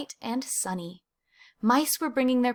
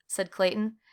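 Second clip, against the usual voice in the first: usual speaking voice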